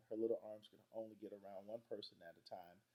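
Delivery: plain reading voice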